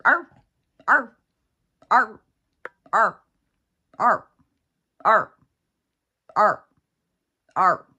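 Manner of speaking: high loud yapping bark; imitated dog yip. Imitating the dog yipping at her over and over. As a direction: yip it out like an annoying little dog.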